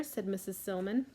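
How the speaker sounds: usual speaking voice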